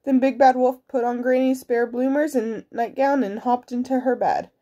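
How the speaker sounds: usual speaking voice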